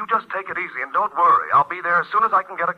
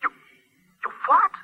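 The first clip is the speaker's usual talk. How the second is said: much higher, a startled yelp of disbelief; shocked cry. Acted. Eric hearing Mary is in jail, a shocked cry. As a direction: blurt it out in sudden shock.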